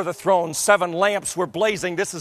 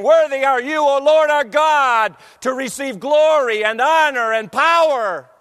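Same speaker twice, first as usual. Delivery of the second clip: loud proclamation; 8 semitones higher, 6 dB louder than usual. Acted. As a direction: proclaim it like a choir in awe. Choir's hymn voiced loudly.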